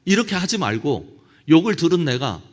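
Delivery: usual speaking voice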